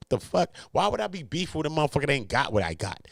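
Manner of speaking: ordinary talk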